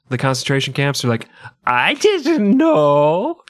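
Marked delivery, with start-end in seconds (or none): 1.7-3.4 high, drawn-out feigned ignorance; mock innocent denial. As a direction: plead ignorance in an exaggerated high wail. Mocking townspeople denying what they knew.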